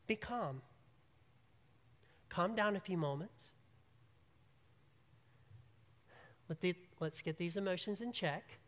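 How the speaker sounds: soft, gentle and steady; calm them down quietly; small voice. Acted. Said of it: "That's the small voice".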